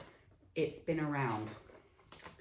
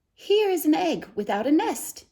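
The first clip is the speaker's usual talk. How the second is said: high, bright discovery, a little louder; amazed boy; 11 semitones higher, 4 dB louder than usual. Acted. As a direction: announce the find with wonder. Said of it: "he said".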